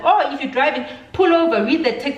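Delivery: high, insistent instruction; sharp retort. Retold or imitated. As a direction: order her about, exasperated. Sharp retort in retold quarrel.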